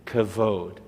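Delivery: kavod with a hard k and a stressed long o at the end; teacher models kavod for the class; Hebrew model word. Shown as a demonstration. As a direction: say kavod as kuh-VODE, the k hard. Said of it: "the first word in this part of the phrase is".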